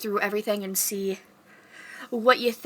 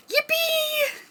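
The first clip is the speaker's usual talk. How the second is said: very high yippee; squeaky cheer. Pretend. Story animal cheering, very high squeal. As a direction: squeal it with glee.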